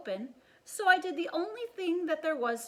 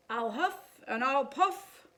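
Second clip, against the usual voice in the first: mock menace; slower than usual. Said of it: "jazzed up the story with all that huff".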